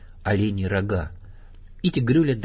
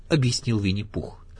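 plain reading voice

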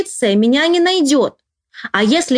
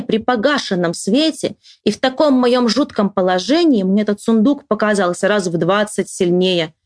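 plain reading voice

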